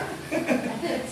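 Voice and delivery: unvoiced chuckle; breathy laugh. Real, not acted. Preacher laughing at the congregation, soft airy chuckle. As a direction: let out a quiet airy laugh.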